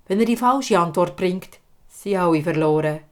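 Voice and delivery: usual speaking voice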